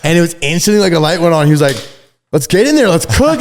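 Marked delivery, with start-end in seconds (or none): 2.3-3.4 much higher, louder hype call; rally the crew to get to work; fired up. Retold or imitated. Friend pumped to start the [name] outfit, hyped up.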